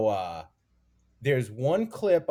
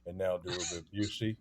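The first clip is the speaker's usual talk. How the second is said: much lower, softer Busey growl; 7 semitones lower, 10 dB quieter than usual. Pretend. Comic hosting an imagined impressions show, as Gary Busey. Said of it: "Gary Busey".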